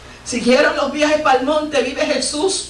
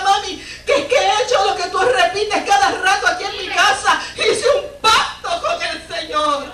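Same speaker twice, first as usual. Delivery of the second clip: laughing with joy; 5 semitones higher than usual. Real, not acted. Mother retells son's pact, laughing.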